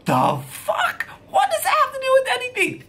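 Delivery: disbelief exploding into a fast, very high scream; outraged shriek. Retold or imitated. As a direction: start incredulous, then screech the question. Outraged at being blamed for drinking.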